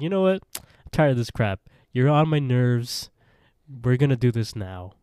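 mock exasperation, jumping up loud at the start; imitated fed-up outburst. Impatient official in retold quarrel.

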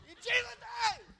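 high, squeezed mock-desperate prayer; frantic serious prayer. Preacher praying inside the money booth, frantic high plea. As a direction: plead it in a small high voice. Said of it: "it's all serious to me".

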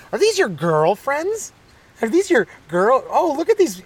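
high, slow, gushing mock parent; hammed-up cooing mom; embarrassing parent. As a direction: tease your kid in a stretched high voice. Parents' teasing acted again.

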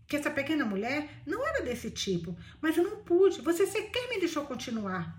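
ordinary delivery, nothing marked

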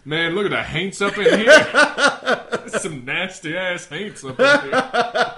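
loud, slow, high, laughing sass; hammed-up sassy laughing. Joking about haints, laughing.

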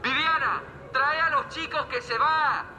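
high, calling through a megaphone; megaphone shout. Acted. Father hurrying his scattered family to the bus, megaphone shout. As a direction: summon the family over a loudhailer. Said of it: "deme ese megáfono".

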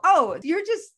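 high, loud catching-on oh; sudden realization. Retold or imitated. Noticing the ego voice at work, catching it out. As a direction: spot the ego's trick with a jolt. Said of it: "and go".

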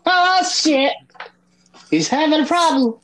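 squeaky high mock concern; high teasing voice. Friend mocking his gaming trouble high-pitched. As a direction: tease him in a high voice.

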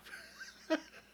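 high airy giggle; high squeaky laugh. Real, not acted. Vlogger laughing at the lyric he just sang. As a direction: let a breathy laugh escape up high.

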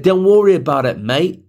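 mock Australian accent. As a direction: brush it off in a fake Australian accent. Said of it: "Are you Australian?".

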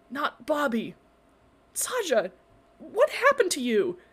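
worried urging. A narrator's reading. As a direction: urge him to answer, alarmed. Worried friend pressing him.

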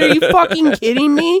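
high, comic incredulous outburst; retold disbelief. Retelling what he said to friend at work, mock outrage. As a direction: re-enact being scandalized by your buddy. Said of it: "I said".

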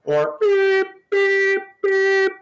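high sustained beeping noise; censor bleep. Pretend. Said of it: "if you hear any".

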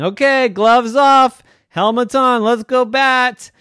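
high, loud, slow coach shout; coach calling out. Acted. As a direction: call the team to bat like a coach.

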